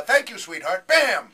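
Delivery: laughing, loud "Thank you, sweetheart" then a shouted Bam; a vase hitting you, played for laughs; mock outrage.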